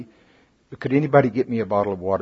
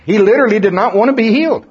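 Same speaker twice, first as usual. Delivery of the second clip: raised and fast, pitched for the laugh; higher, faster, amused telling; amused disbelief; 9 semitones higher, 5 dB louder, faster than usual. Real, not acted. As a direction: land the punchline high and quick. Punchline of funny story.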